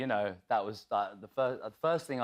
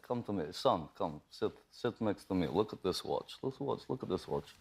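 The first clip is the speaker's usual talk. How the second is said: put-on Russian accent in character; 3 semitones lower than usual. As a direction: imitate an actor's low-key Russian accent. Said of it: "He's talking like this?".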